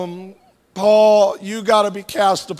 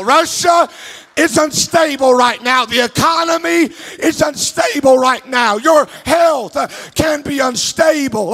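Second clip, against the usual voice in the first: higher, louder fervent preaching with sung tones; preaching at full force; 4 semitones higher, 4 dB louder than usual. Acted. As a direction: lift the congregation, preach it out.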